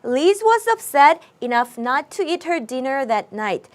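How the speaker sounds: slow, clear English read-aloud for the class; slow model reading. Shown as a demonstration. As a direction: read each word slowly so students can follow. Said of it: "이어볼까요?".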